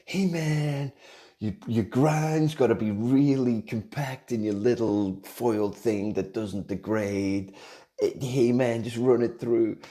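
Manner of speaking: high, loud mock-cool voice; George Clooney impression. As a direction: ham up a laid-back movie star giving coffee tips. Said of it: "the worst impression of George Clooney ever".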